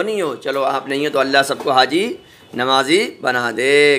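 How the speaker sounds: ordinary talk, nothing marked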